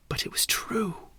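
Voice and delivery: hushed secret reveal; whisper. A narrator's reading. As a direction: whisper it as a twist.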